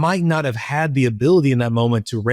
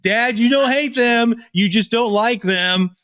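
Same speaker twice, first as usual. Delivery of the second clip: put-on higher, slow, a little girl scolding her father; mock scolding from the hate police; child correcting dad; 8 semitones higher, slower than usual. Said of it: "I'd hear her scream".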